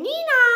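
high, loud nee-naw; siren imitation. A narrator's reading. Cops arriving for the thieves, high siren noise. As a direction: wail like a police car.

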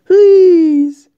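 loud, high desperate please; pleading shout. Real, not acted. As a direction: beg loudly to make it stop. Begging friend to stop.

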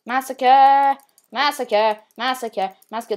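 loud announcer call fading out. Pretend. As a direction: call it out, then fade. Echoing game announcer, fading.

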